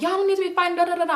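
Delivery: high mock grandma nagging; scolding grandma. Grandma breaking up the sisters' fight, high mock scolding. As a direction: nag in a high, mimicking singsong. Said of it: "my grandma end up coming there".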